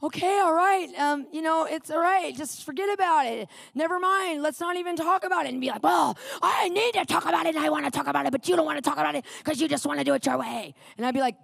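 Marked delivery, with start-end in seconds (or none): 0.0-5.5 higher-pitched, appeasing voice; put-on conciliatory dismissal; placating. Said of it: "Trying to be calm and non-prideful". 5.8-10.7 put-on indignant insistence; worked-up rant, speeding up at the end; mock battling. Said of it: "he turned his full, like, battle".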